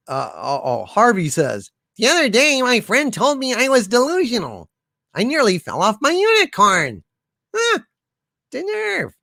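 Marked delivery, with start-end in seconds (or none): 2.0-7.0 high, odd character voice; high put-on character voice. Voicing Harvey's quote in character. 7.6-9.1 very high, soft indignant squeak; squeaky mock outrage. Mock offense at delusional.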